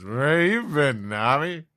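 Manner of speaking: put-on a loud sloppy drunk calling out; drunk Usopp. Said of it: "he burps and says".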